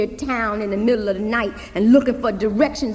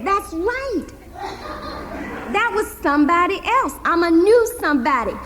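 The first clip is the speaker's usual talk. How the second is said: uncle's voice; 8 semitones higher, 4 dB louder than usual. Acted. Uncle plays along.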